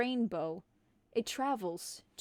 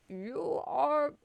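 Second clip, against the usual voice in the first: slipping into Dumbledore; 4 semitones higher than usual. Acted. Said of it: "I'm slowly trying to become Dumbledore instead of Santa".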